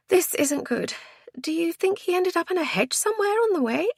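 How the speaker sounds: high, worried female character; high-voiced anxious question; worried woman. A narrator's reading. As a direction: pitch it high and fret aloud.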